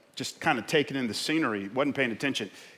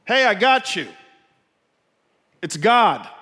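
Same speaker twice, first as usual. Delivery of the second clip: loud, raised call from on high; voice from heaven crying out; 9 semitones higher, 11 dB louder than usual. Acted. God answering the man on the branch, crying out. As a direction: play God calling down to a man. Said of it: "a voice from heaven cried out".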